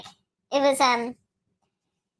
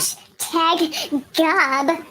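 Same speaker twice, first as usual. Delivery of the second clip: slow, drawn-out goofy voice; silly put-on voice; 2 semitones higher, much slower than usual. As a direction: stretch it out in a joke voice. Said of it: "you need to use that, uh, that voice".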